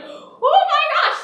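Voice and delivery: very high, loud drawn-out exclamation; mock horrified shock. Dad seeing his screaming son's hand, hammed-up horror. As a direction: stretch out a comic shriek of alarm.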